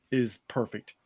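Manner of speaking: normal talking voice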